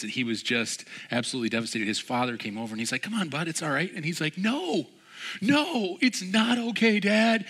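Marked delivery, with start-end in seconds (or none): 3.0-3.9 comforting dad. Retold or imitated. Father comforts crying son. 4.4-7.4 put-on high, louder wail of a heartbroken kid; imitating a devastated child, pitched high; upset crying boy. Devastated boy answers his father.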